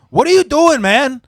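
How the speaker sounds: loud, high-pitched imitation of protest; alarmed scolding. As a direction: yell it as the retold angry bystander. Van owner scolds forklift driver.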